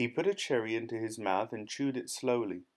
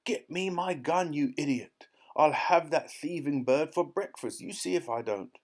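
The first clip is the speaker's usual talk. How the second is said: high, outraged blustering; high-pitched furious threat; angry shouting; 5 semitones higher than usual. A narrator's reading. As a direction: rage at a useless servant. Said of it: "the Duke was shouting".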